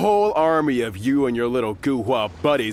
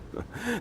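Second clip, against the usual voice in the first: short high mocking chuckle; a quick sneering laugh; 12 semitones higher, 11 dB quieter than usual. Thug laughing at the young swordsman, high mocking chuckle.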